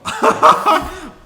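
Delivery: high, loud burst of laughter. Real, not acted. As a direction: crack up at your own impression. Cracking up at own impression.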